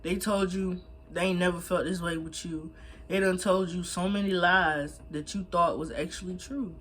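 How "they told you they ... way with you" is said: ordinary talk, nothing marked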